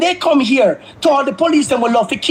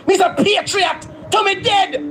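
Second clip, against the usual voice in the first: ordinary delivery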